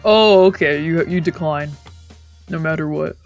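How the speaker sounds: normal talking voice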